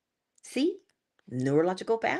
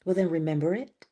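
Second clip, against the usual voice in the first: usual speaking voice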